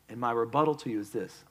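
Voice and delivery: normal talking voice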